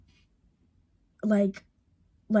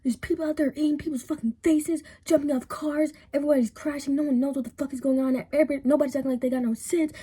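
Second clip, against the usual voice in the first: higher-pitched frantic rant; retold panicked yelling; 6 semitones higher than usual. Dad bursting in about the chaos outside, mock yelling. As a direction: rattle it off high, in mock yelling. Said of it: "like, yelling".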